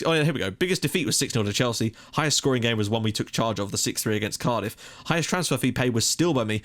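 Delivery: usual speaking voice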